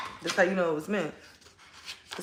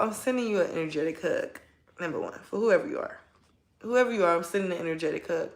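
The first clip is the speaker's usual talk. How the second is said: usual speaking voice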